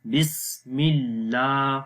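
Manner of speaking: slow بسم اللہ, holding 'laa' long; lengthened laam. Shown as a demonstration. Teacher showing the standing zabar, 'laa' in اللہ drawn out. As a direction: stretch the thin 'laa' in اللہ. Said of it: "اس کو لمبا کریں گے".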